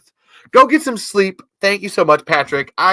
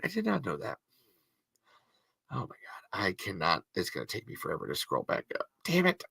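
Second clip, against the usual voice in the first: soft, much quieter muttered aside; muttering quietly; 6 semitones lower, 16 dB quieter than usual. Real, not acted. Host muttering to herself while scrolling chat, very soft. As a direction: mumble to yourself while scrolling.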